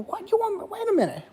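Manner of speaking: very high, squeaky disbelief; shocked disbelief. Retold or imitated. To the therapist holding the tutu, squeaky shocked disbelief. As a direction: shoot up high in total shock.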